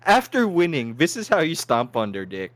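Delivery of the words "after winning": ordinary talk, nothing marked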